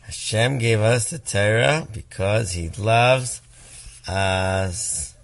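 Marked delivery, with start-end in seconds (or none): none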